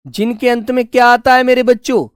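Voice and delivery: usual speaking voice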